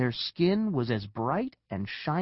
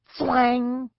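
high, loud twanging noise; arrow twang; 11 semitones higher, 5 dB louder than usual. A narrator's reading. Narrator as an arrow flies past, loud high twang. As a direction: voice a sharp "thwang".

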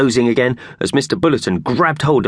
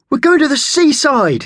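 high, loud squeaky cheer; excited shout; 6 dB louder than usual. A narrator's reading. Mickey Thompson the mouse shouting about the seaside. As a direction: yell it out like an overjoyed mouse. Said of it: "shouted Mickey Thompson".

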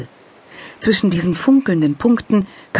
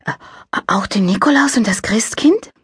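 put-on whispered, awed child; breathless excitement; 8 semitones higher than usual. A narrator's reading. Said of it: "Mund wurde vor Aufregung ganz trocken".

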